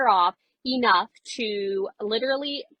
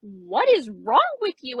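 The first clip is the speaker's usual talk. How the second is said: shocked outcry; 4 dB louder, slower than usual. Retold or imitated. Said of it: "And I'm like".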